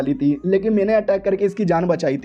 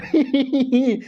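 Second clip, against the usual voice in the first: gloating laugh; 6 semitones higher than usual. Acted. Hero gloats.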